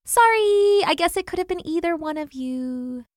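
high little bird voice; apologetic hummingbird. A narrator's reading. Hummingbird apologising to bees and wasps, high voice. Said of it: "still talking at a rapid pace".

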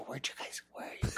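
hushed unvoiced call to the crowd; playful stage whisper; mock whisper. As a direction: whisper it like searching for hiding people. Whispering to a hidden audience.

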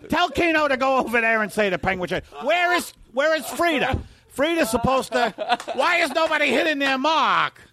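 frantic director. Retold or imitated.

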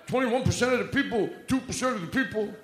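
a light political impression; Donald Trump and Bernie Sanders. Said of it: "I do, uh, Donald Trump and, uh, Bernie Sanders together".

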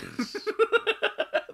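high breathy laugh. Real, not acted. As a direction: burst out laughing. Laughing at the story.

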